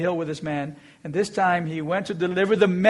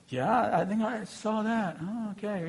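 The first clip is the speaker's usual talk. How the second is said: soft, dazed bystander voices muttering; hushed stunned bystanders; 10 dB quieter than usual. Retold or imitated. Onlookers whisper to each other, quietly stunned. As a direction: gawk quietly at what just happened.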